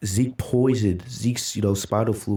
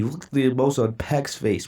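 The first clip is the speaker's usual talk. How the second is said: usual speaking voice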